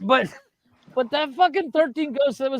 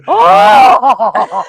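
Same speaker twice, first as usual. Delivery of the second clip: a sudden loud "Oh!" of alarm; startled shout; 12 dB louder than usual. Retold or imitated. Recalling her forgotten shovel, a loud startled cry. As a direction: yelp like you just spotted something.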